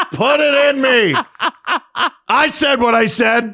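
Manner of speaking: laughing. Real, not acted. Laughing through joke.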